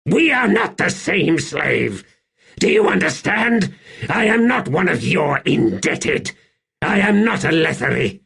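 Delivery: high-pitched indignant anger; sudden rage. A narrator's reading. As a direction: lash out at a slave in offended fury. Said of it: "his eyes flashing with sudden rage".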